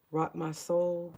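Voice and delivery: ordinary talk, nothing marked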